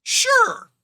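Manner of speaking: very high, loud "sure"; exaggerated agreement. Game master giving in to a player's question, exaggerated agreement. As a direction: squeal out a loud, comically high yes.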